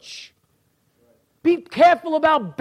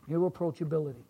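softly repeated; 8 semitones lower, 11 dB quieter, much faster than usual. Shown as a demonstration. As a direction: echo "Irreproachability" low and quiet.

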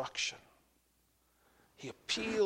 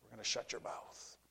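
soft, menacing aside; hushed threat; 3 semitones lower, 13 dB quieter than usual. Acted. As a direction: drop to a low, quiet warning. Crowd's threat finished after pause.

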